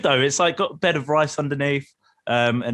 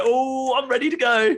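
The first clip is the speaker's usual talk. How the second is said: jolted awake; 13 semitones higher than usual. Retold or imitated. Strong coffee kicks in.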